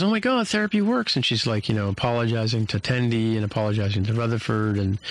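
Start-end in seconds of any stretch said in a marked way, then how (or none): 0.0-1.1 much higher, delighted surprise; as if it just dawned on her; amazed realization. Retold or imitated. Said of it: "she says".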